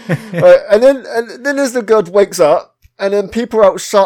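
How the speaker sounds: normal talking voice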